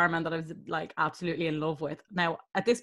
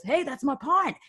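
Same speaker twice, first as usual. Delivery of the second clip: higher-pitched mock indignant Aussie; Australian punter; 7 semitones higher than usual. Australian customer spots his Guinness behind the bar, mock indignant. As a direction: claim it like an affronted Aussie drinker. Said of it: "This Aussie guy".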